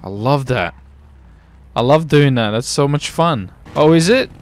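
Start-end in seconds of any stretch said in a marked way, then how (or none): none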